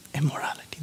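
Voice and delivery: breathy whispered emphasis on the key word. Real, not acted. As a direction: drop to a whisper to land it. Preacher drops to hushed emphasis.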